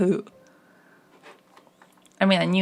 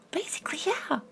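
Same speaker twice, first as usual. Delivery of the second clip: higher, breathy whispered aside; whispering; 8 semitones higher, 8 dB quieter than usual. Real, not acted. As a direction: drop to a whisper. Sudden unvoiced aside.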